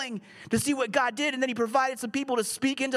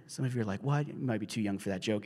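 much lower and softer, under the breath; quiet joke aside; 13 semitones lower, 6 dB quieter, faster than usual. Real, not acted. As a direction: drop out of preaching for a low throwaway remark. Aside after a joke falls flat.

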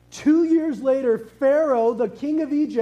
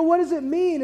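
anxious question; 6 semitones higher than usual. Acted. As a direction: beg for an answer to the dream. Dream-troubled prisoners asking Joseph.

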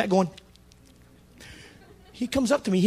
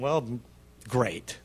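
low, soft deadpan; flatly sarcastic under your breath; sarcastic great; 6 semitones lower, 7 dB quieter than usual. Retold or imitated. Preacher voicing his grudging thought.

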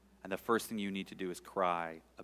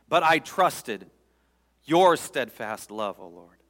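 slow, loud trust; 2 semitones higher, 14 dB louder, slower than usual. Shown as a demonstration. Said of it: "I'm gonna read it slowly".

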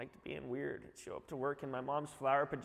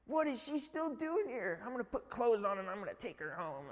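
irritated dad; 8 semitones higher than usual. Retold or imitated. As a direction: squeak it out like an outraged dad. Dad voiced demanding girlfriend leave.

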